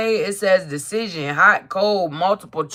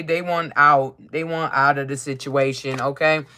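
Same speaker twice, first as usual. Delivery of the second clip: ordinary delivery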